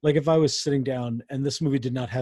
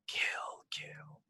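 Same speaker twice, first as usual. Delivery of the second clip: whispering; 13 dB quieter than usual. Retold or imitated. Imitating a whispered movie voice.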